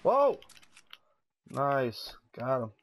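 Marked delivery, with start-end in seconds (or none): 0.0-0.4 loud, high-pitched yelp; real burst of alarm; startled shout. Real, not acted. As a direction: cry out in sudden surprise. Sudden surprise in a firefight.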